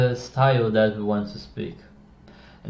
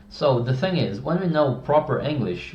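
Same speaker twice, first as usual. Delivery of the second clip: ordinary talk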